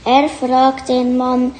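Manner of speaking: ordinary delivery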